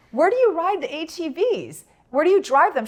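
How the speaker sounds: high, exasperated retold question; baffled demand. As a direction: act out bewildered disbelief. Baffled retort to friend's explanation.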